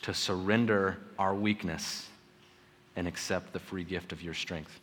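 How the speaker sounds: low, soft prayerful voice. Real, not acted. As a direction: close in quiet, reverent prayer.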